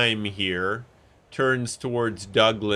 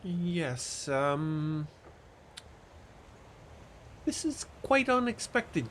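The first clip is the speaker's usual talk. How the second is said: higher, softer ladylike voice; lady's voice; 6 semitones higher, 3 dB quieter than usual. Acted. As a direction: speak as a genteel lady. Diza voiced by game master.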